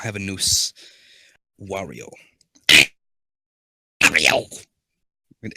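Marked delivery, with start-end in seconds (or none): 4.0-4.6 low, loud cartoon villain voice. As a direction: drop the pitch and belt it out. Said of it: "It's gonna be Wario".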